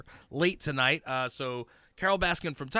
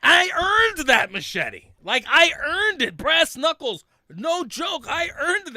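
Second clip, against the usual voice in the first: proud boasting; 11 semitones higher, 6 dB louder, slower than usual. Real, not acted.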